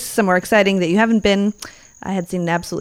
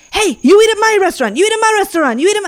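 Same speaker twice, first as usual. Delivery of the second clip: pushy street tout; 12 semitones higher, 5 dB louder, faster than usual. Retold or imitated. Restaurant touts calling out.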